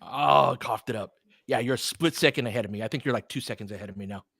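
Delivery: ordinary delivery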